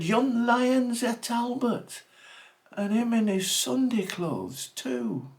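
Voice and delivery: aggrieved outcry. Acted. Pa complains to the manager.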